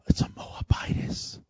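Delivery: hushed gossiping whisper. Retold or imitated. Townsfolk gossiping about Naomi's foreign daughter-in-law, whispered. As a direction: whisper it like a scandal.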